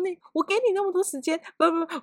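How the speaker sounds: high-pitched mock scold. 高声模仿骂同事. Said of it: "我就骂他".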